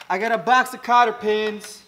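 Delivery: excited loud boast. Real, not acted. Mechanic telling viewers about his pins, excited shout. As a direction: shout it out like showing off his stash.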